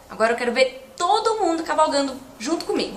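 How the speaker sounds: ordinary delivery, nothing marked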